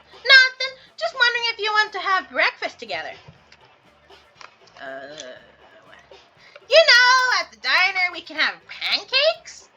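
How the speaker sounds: high, bright eager caller; cheerful young friend. Acted. Damien voice again.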